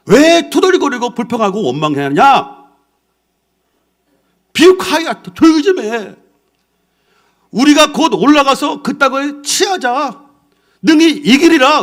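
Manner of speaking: high, loud rallying cry, slow on 우리가; Caleb shouting. Acted. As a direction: stand up and roar the crowd into silence. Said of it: "갈렙이 모세 앞에서 번쩍 일어나더니".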